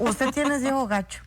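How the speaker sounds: mother telling daughter she looks pregnant, lightly imitated; mother's knowing remark. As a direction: lightly voice mom's sly observation. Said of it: "pero así".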